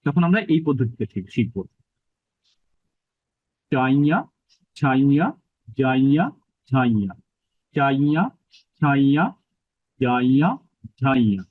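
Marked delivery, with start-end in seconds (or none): 3.7-11.4 চাইয়া, ছাইয়া, জাইয়া rising through the nose, ঝাইয়া falling; measured chant, each ending sounded nasally on the palate; nasal palatal drill. Shown as a demonstration. Teacher drilling the palatal nasal for learners, nasal recitation. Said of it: "আমি কিন্তু নাসিকাতে উচ্চারণটা করছি".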